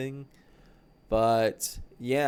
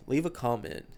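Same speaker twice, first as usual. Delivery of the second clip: normal talking voice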